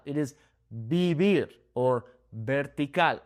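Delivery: "vivir" and "vertical" with soft B sounds, bee-BEER; Spanish soft B in "vivir". Shown as a demonstration. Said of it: "It makes that soft B sound".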